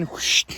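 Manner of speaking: spoken whoosh sound effect; whooshing noise. Shown as a demonstration. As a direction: make a quick airy whoosh. Imitating a noise while showing layout.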